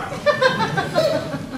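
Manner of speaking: short high scornful laugh. Acted. Wife laughs at her husband's murder wish, scornfully. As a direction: laugh him off.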